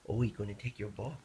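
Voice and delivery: ordinary talk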